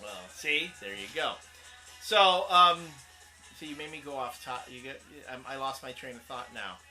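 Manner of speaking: normal talking voice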